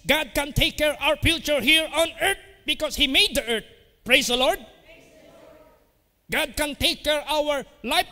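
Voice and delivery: high, loud preaching voice; fervent pulpit cry; full-force preaching. Acted. Preacher raises voice in sermon.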